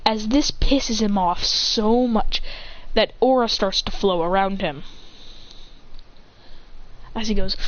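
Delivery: ordinary delivery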